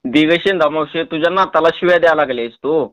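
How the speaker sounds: ordinary talk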